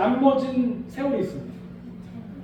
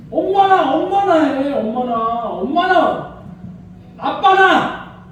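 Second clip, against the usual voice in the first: high, loud whiny calling out; childish whine; 5 semitones higher, 7 dB louder, much slower than usual. Retold or imitated. Preacher imitating a whining child, loud high calls. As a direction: whine it like a child throwing a tantrum. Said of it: "이렇게 얘기를 하잖아요".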